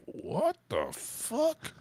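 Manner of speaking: slow, hushed incredulous question; exaggerated stunned disbelief; mock disbelief. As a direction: draw it out as a stunned audience reaction. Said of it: "the audience felt a little bit like".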